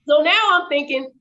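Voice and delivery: usual speaking voice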